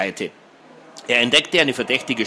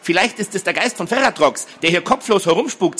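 put-on higher, uneasy girl's voice; alarmed speculation; 7 semitones higher than usual. A narrator's reading. Girl warning of a headless ghost, alarmed. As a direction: spook the others with a nervous theory. Hedi fearfully imagines a ghost.

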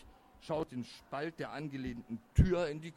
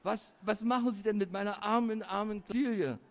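higher-pitched, distressed pleading; horrified wailing; 6 semitones higher, 4 dB louder than usual. A narrator's reading. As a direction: wail it in dismay, stumbling on the start. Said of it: "schluchzte es da entsetzt".

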